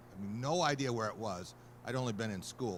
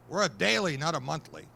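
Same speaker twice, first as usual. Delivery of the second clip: loud, higher barked put-down; gruff scolding; 6 semitones higher, 9 dB louder than usual. Retold or imitated. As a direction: editor leaning over, loudly mocking the new guy. Editor scolding slow typist.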